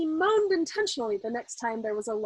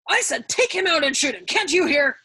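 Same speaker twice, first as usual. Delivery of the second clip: impatient shout; 5 semitones higher, 7 dB louder than usual. A narrator's reading. General repeating his order.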